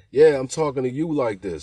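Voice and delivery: loud, defiant retort; loud comeback. Retold or imitated. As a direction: throw it right back at her, loud. Driver fires back at passenger.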